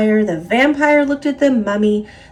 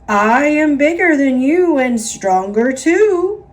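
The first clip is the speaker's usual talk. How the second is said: boastful; 4 semitones higher, 5 dB louder than usual. A narrator's reading. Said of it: "boasted the ghost".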